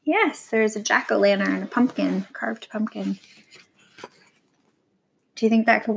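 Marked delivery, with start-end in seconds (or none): 2.3-3.2 soft whisper, no voice. Shown as a demonstration. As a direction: breathe it like a secret. Repeats word, then whispers it.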